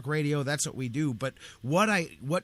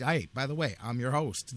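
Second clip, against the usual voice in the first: ordinary talk, nothing marked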